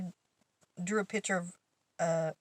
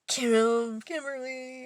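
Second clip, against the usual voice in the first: mock scolding; 5 semitones higher, 5 dB louder, much slower than usual. Coworker scolds after reveal.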